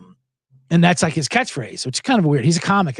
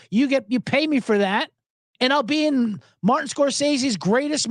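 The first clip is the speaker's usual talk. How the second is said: high-pitched, aggrieved diner owner; exasperated high mock tirade; worked-up owner rant; 9 semitones higher than usual. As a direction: imitate an owner venting, fully into it. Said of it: "Now I'm really getting into it".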